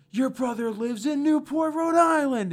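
high, loud, slow accusing blurt; mock furious husband yell; angry interruption. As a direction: call her out on air, furious. Joe bursts in angrily.

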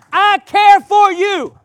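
fervent sermon delivery; preaching. Acted. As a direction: preach it out with full force. Preaching at full force.

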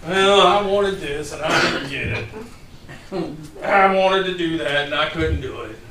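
slow, drawn-out put-on whine; mock sulking. Husband not getting his way complains to wife, mock sulking. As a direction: play up a childish sulk. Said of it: "I'll sulk in front of my wife".

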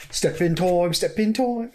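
chimney sweep Cockney, rising; light Mary Poppins Cockney chant; hint of a Cockney chimney sweep; mock Cockney singsong. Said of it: "the old chimney sweep".

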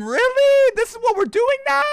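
can't believe what you're seeing; incredulous at the new taunting rule. Real, not acted. Outraged disbelief at rule.

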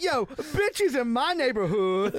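high, loud, slow, through laughter; can't keep a straight face doing him; laughing while quoting him; laughing imitation. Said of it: "He was like".

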